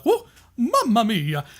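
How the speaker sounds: high, drawn-out cartoon Italian yelp; Mario. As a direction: imitate a video game hero thrown backwards. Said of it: "Mario would get thrown ... he would be like".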